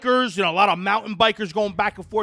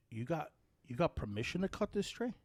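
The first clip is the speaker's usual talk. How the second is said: low, soft imitation of a nosy cyclist; quiet retold challenge; 4 semitones lower, 17 dB quieter than usual. Cyclist asks tree owner about permission, quiet retold challenge. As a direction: quote him softly, setting up the blow-up.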